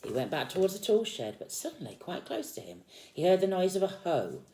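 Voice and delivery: put-on lively read-aloud to a small child; storybook reading. A narrator's reading. Parent reading Peter Rabbit to a child, lively storytelling. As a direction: read the picture book out with energy.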